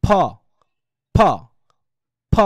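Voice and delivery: "ফ" as an aspirated P sound; sound demo. Shown as a demonstration. Teacher shows students the IPA sound, pronunciation demo. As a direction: puff out "ফ" separately, as a bare sound. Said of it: "এটার উচ্চারণ কি হবে".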